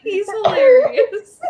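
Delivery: laughing. Real, not acted.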